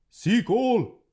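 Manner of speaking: high, loud sharp yell; loud shouted order; yelled command. A narrator's reading. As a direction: loose the hound with a shout. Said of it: "the voice yelled again".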